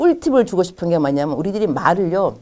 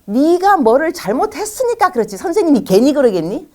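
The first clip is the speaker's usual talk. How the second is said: scolding mother; 8 semitones higher than usual. Retold or imitated. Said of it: "보통 엄마들이 어떻게 말하냐면".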